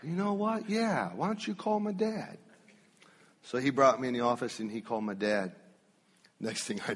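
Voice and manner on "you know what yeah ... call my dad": put-on eager teen. Teen eagerly agrees with boss.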